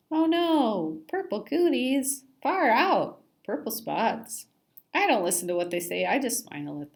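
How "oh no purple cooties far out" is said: high mock alarm. Fish playing along with joke.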